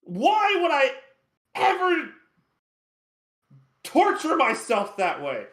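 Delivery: high, slow indignant whine; indignant imp. Acted. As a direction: protest like an offended imp. Chard protesting theatrics.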